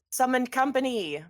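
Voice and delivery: formal announcement. Acted. Tall woman announcing the guests in a loud herald voice. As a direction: announce them grandly to the hall.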